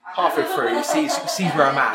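usual speaking voice

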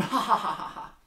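high, loud staged belly laugh; mock cracking up. Joke teller acting the whole jail cracking up, mock laughter. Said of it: "everyone in the whole jail just starts cracking up".

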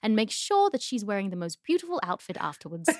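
hammed-up royal English accent; Prince William. Prince boasting about his wife, mock-royal English accent. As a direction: keep up the proper princely English. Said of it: "do you like Prince William".

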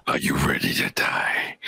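very low, raspy whispered threat; imitating the badass villain. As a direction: whisper it way down low, like a killer clown. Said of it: "Like that guy?".

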